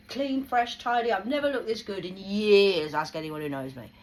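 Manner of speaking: real pride, nearly shouting; proud boast. Real, not acted. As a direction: show off how well you look. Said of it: "proud of her looks".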